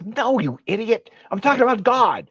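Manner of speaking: mocking the angry opponent.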